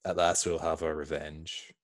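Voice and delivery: a hint of a menacing Sith; villain voice. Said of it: "What's Maul like?".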